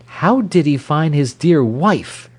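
high, sarcastic exclamation; crying out. A narrator's reading. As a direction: cry out as the slighted wife. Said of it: "cried Mrs. Goyte".

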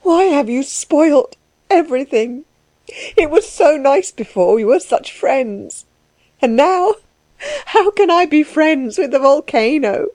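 high, plaintive lament; upset woman, plaintive and halting; tearful reproach. Acted. Tearful reproach to the suitor continues.

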